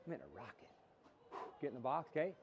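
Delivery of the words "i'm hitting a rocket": low, soft, certain; low confident vow. Shown as a demonstration. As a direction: say it quietly like a promise. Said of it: "There's two strikes".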